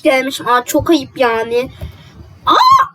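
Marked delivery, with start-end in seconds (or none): 2.5-2.8 very high, loud shocked "Aa!"; seeing someone thought dead; shocked gasp. Acted. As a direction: shriek of surprise. Shocked at seeing her alive.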